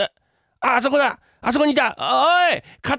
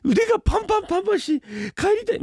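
whining, near tears; 14 semitones higher than usual. Acted. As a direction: whine like a worn-out kid. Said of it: "泣くんじゃないよ". (泣きべそで弱音を吐く)

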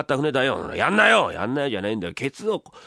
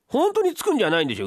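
high, alarmed question; startled wife; 10 semitones higher, faster than usual. Acted. Wife worried he'll really pound mochi, high alarm. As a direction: gasp in disbelief.

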